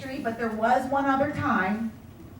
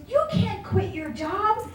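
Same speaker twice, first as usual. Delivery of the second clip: high, slow mocking husband voice; a hammed-up doubting husband; husband's scoffing; 11 semitones higher than usual. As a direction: mimic him scoffing at the plan. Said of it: "He goes".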